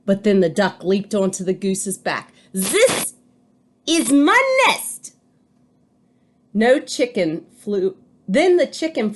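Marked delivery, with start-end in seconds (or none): 2.5-5.2 high, loud, slow insistence on MY; a bird shouting it's hers; bird claiming nest. A narrator's reading. Duck shouts at goose, high and loud.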